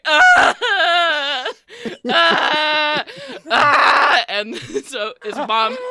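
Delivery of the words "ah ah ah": loud, high crying wails; loud sobbing toddler shouts; kid wailing. Acted. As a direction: bawl like an overwhelmed little kid. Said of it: "Jimmy's just like".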